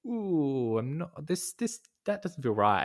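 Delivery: high, uneasy unsettled reaction; a room suddenly feels wrong; mock unease, voice lifting high; uneasy ooh. Imagined uneasy reaction entering room.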